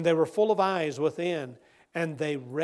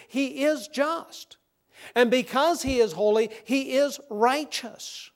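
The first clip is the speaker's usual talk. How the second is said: preaching at full force; 6 semitones higher, 5 dB louder than usual. Acted. Preaching builds to declaration.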